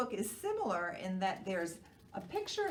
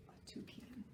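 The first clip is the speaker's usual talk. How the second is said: whisper; 4 semitones higher, 17 dB quieter than usual. Acted. Whispered reveal to children.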